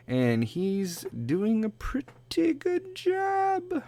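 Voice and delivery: slow unvoiced whisper. Real, not acted. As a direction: whisper it as an aside. Aside about friend.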